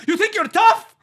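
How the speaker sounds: high, loud mock yell of a huge Russian guy; angry Russian guy. Comic retelling of the Russian driver yelling over his truck. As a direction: yell it like the giant guy whose truck got spat on. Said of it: "He's like".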